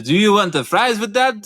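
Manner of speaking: wobbly mock French accent. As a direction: have a go at a French accent, sliding towards Russian. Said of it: "maybe in, in France".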